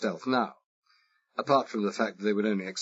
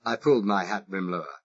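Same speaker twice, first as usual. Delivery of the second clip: ordinary delivery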